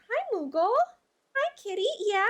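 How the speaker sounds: high sweet voice for the cat; pet talk voice. Real, not acted. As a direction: coo at the cat.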